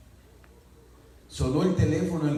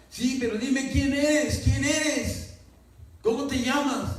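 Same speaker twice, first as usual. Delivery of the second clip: wife pressing; 9 semitones higher, slower than usual. Retold or imitated.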